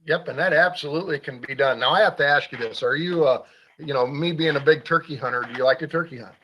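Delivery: ordinary talk, nothing marked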